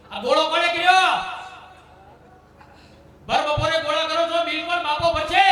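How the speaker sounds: high, loud furious rant; husband raging about the electricity bill; angry yelling. Retold or imitated. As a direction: blow up at the family over the light. Man yelling about bulb left on.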